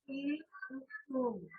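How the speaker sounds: soft ई उ with lips barely parted; close vowel demo. Shown as a demonstration. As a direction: show that the mouth stays nearly shut. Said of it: "बोल रहे हैं तो मुंह हमें खोलना पड़ रहा है?".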